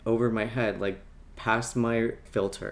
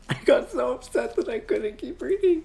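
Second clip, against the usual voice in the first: laughing through it, high; 13 semitones higher than usual. Real, not acted. Laughing to viewers about being too upset to read. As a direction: laugh while admitting it.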